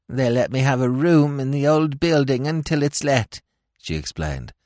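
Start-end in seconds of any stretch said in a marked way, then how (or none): 0.1-3.4 higher crone's whine; old woman voice. A narrator's reading. Old woman explaining her room to Smith, higher whine. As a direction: keep the frail beggar act going.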